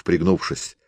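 plain reading voice

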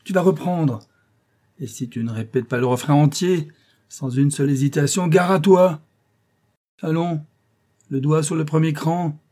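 high-pitched stern orders; exasperated music master laying down orders; threatening scolding. A narrator's reading. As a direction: warn the pupil, building to a loud threat. Teacher threatening pupil.

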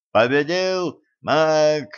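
high, drawn-out announcer's call; loud, slow ring announcement; shouting over crowd. A narrator's reading. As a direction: raise the voice and stretch out the winner's announcement. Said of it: "стараясь перекричать толпу".

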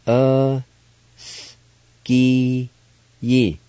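Uh, s, ki, yi spaced out, the s a bare hiss; syllable by syllable. Shown as a demonstration. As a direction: sound out Uh, s, ki, yi slowly. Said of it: "It's pronounced like this".

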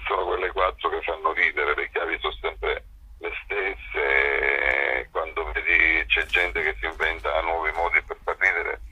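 normal talking voice

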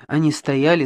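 usual speaking voice

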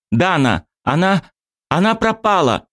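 high, frantic cry of alarm; urgent panicked report. Acted. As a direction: blurt it out, panicking. Panicked friend reports disappearance.

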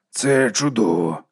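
very slow, measured wonder; slow reflective voice; marvelling. A narrator's reading. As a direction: let each word stretch out. Old knight marvels quietly.

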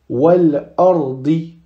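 slow, stretched wal ardi, L sounded; lam pronounced. Shown as a demonstration. Teacher reading a hamza example, lam pronounced. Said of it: "Pareil, la hamza, lettre lunaire".

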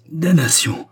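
crié bien plus haut et plus fort; un cri de douleur qui éclate; hurlement. A narrator's reading. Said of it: "hurla-t-il". (Boulba hurle de douleur, haut et fort)

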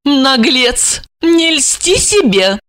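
high, slow icy rebuke; cold reply. A narrator's reading. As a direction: cut him down coldly. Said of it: "холодно ответила Ольга". (холодно ответить)

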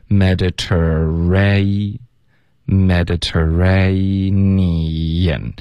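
very slow, stretched Mediterranean; syllable-by-syllable demo. Shown as a demonstration. Teacher teaching students the word, very slowly syllable by syllable. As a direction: draw out each syllable of Mediterranean, R sounded in "ter". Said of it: "所以我们就发".

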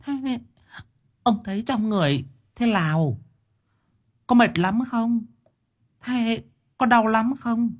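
put-on high, gentle fussing old wife; wife murmuring. A narrator's reading. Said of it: "Miệng bà thỏ thẻ". (vợ già thỏ thẻ hỏi han)

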